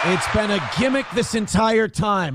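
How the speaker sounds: mock outraged shout. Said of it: "mock outrage at Blind Mike".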